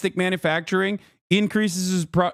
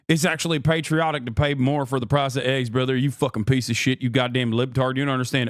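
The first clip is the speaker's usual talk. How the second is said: lower, fast mock rant of an angry patriot; 7 semitones lower than usual. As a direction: sneer it like an online troll. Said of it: "some people are gonna turn around and say".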